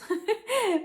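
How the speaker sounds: sudden bright laugh at her own slip; high burst of laughter. Real, not acted. Vlogger laughing at her own slip, a high burst of laughter. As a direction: laugh out loud at the mistake.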